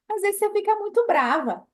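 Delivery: high, loud complaining voice; high teasing imitation of a follower; mock whiny complaint. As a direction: whine it like a hurt follower. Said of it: "acted dialogue".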